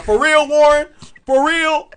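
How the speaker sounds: loud, high mock indignation; Lacey. Imitating a friend challenging his taste, mock indignant. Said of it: "can't you hear Lacey's voice right now?".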